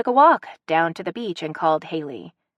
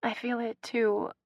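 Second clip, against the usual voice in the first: much softer, hushed confession; barely above a whisper; 6 semitones higher, 8 dB quieter than usual. A narrator's reading. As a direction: breathe it out just above a whisper. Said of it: "barely above a whisper".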